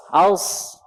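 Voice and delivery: Aus with a thick full s; heavy Aus. Shown as a demonstration. Teacher demonstrates sod with sukun. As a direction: fill the mouth on Aus. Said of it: "Sod sukun gimana".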